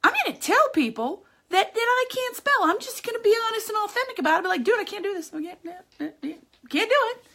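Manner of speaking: high, pumped-up inner voice; 1 bold resolve. Retold or imitated. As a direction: psych yourself up to finally own it. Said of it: "I was like".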